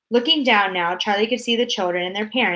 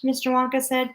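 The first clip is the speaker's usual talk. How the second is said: ordinary delivery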